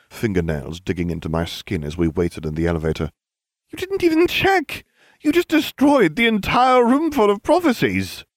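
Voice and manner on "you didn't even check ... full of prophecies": high, loud, outraged scolding; outraged shout. A narrator's reading. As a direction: scold him, aghast.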